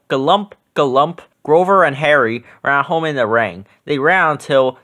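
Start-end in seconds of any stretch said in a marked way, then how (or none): none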